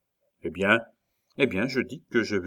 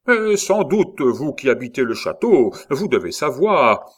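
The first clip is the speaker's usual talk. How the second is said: taquin; 4 semitones higher, 5 dB louder than usual. Acted. Saint-Luc taquine le comte.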